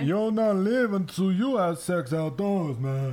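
put-on high, slow old man voice. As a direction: play a leering old neighbor. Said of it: "if I do it in an old man voice".

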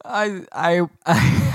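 normal talking voice

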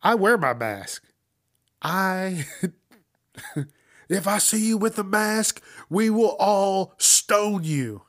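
over-the-top pulpit parody, slow at the end; exaggerated preacher parody. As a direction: ham up a zealot preacher threatening the crowd. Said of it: "I'm just taking it a little further".

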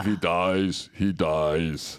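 low-pitched Russian movie villain; deadpan, low Russian-accented boxer; Ivan Drago. Retold or imitated. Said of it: "you're gonna go Ivan Drago".

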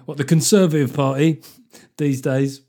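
ordinary talk

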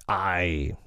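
drawn-out, understated pirate snarl; a touch of surly menace; mild snarl. A narrator's reading. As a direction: grumble it like a sullen crew. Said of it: "they snarled".

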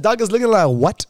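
loud, high "looking like what?"; shocked disbelief. Retold or imitated. Patient shocked at cancer news, loud disbelief. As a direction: blurt it out stunned. Hearing possible cancer diagnosis.

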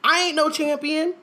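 high-pitched comic disclaiming; mock protest. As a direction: protest as the hapless champion, hammed-up. Mock protest in hapless champion's voice.

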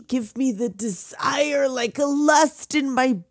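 a touch of hammed-up Salieri despair; anguished Salieri. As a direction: rail at God for the denied gift. Voicing Salieri's bitter complaint to God.

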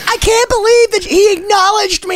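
high-pitched mock-gushing mom voice; imitating mom. Mom calling her son about being acknowledged, high gushing impression. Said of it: "She's gonna call me up and be like".

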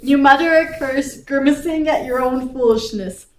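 ordinary delivery

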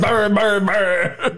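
mock incantation. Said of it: "the Rivendell one where he's like".

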